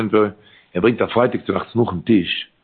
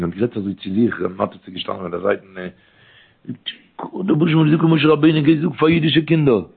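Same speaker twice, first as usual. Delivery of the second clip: ordinary talk, nothing marked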